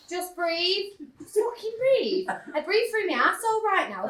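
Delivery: imitating A and E staff; mock nurses. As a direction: parody the staff telling her to breathe. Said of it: "they're giving it".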